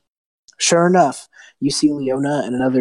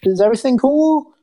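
high, tentative calling-out voice; caller at the door; 9 semitones higher, 5 dB louder than usual. Acted. Townsperson knocks at castle gates to check, tentative. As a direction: call up high through a closed door. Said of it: "someone knocking on the door going".

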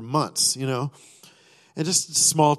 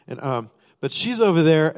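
usual speaking voice

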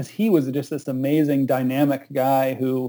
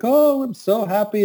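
teary happy; 8 semitones higher, 3 dB louder than usual. Retold or imitated. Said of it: "was crying".

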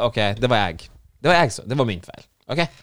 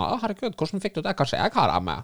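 higher, mock-shocked gasp of a woman; mock surprised woman; 8 semitones higher than usual. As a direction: imitate her put-on surprise, higher. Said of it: "så sa hun sånn".

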